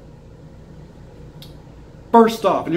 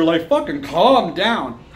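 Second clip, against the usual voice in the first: slow, exasperated calm down; 5 semitones lower, slower than usual. Real, not acted. Fan telling other fans, slowly, to calm down. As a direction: tell them to calm down, drawn out.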